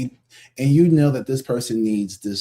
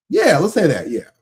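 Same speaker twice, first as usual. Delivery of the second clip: sarcastic yeah; 7 semitones higher, 6 dB louder than usual. Acted. Sarcastically agreeing with excuse.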